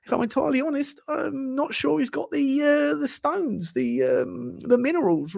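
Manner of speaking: imitating a mild ex-manager pundit; Glenn Hoddle. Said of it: "Glenn Hoddle talks a little bit like this".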